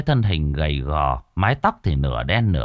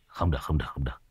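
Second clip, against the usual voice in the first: trailing off into a soft mumble, lower; fading mutter; 6 semitones lower, 9 dB quieter, much faster than usual. A narrator's reading. Old sorcerer trails off muttering alone, soft fading mumble. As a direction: mumbles it away to himself, softer and softer. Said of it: "Lão vừa lẩm bẩm".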